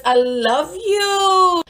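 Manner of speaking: high, long-drawn declaration of love; gushing. Real, not acted. Shout-out to her therapist, high drawn-out gushing.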